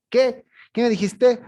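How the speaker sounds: loud, high-pitched challenge, acted out. Shown as a demonstration. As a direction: fire it back like someone easily worked up. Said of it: "siempre un poco más alto de lo general".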